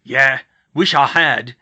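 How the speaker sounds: loud, slow growl; drawn-out, forceful grumble. Acted. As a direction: growl it with regret as a gruff big man. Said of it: "he growled".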